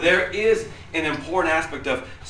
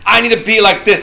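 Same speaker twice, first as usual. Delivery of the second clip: louder, higher, pushed-out resolve; forced self-talk; 7 semitones higher, 12 dB louder, faster than usual. Acted. Preacher mimics someone trying too hard, forced self-talk. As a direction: push it out like a loud vow to yourself.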